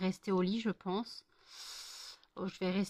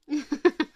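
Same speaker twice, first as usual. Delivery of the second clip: laugh; 6 semitones higher, 8 dB louder than usual. Real, not acted. Laughing at the dog.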